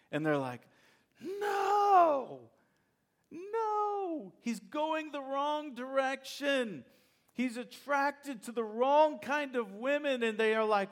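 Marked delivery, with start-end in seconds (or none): 1.2-2.4 sharp shouted no, much higher; parents shouting no. Acted. Parents to Samson, shouting a horrified no. 3.3-10.2 dismayed parents. Acted. Parents lament son's choice.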